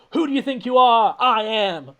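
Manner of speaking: high, loud hammy showdown; mock dramatic. Sending up the film's big moment, mock dramatic. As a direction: belt it out as overblown movie confrontation.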